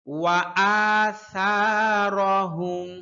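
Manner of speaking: আসারহুম with a light, thin সা stretched one alif. Shown as a demonstration. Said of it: "পাতলা করে উচ্চারণ করতে হবে". (পাতলা করে সা টেনে পড়া)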